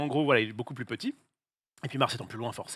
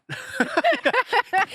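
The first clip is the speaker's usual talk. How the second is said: high laugh; 4 dB louder than usual. Real, not acted. Laughing at kebab joke.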